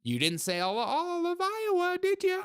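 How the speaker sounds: high squeaky mock protest; mock retort. Host mimics an objection to the claim, mocking falsetto. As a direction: shoot up into falsetto to nitpick. Mocks critic nitpicking her claim.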